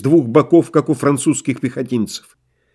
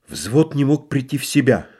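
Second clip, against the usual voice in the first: ordinary delivery, nothing marked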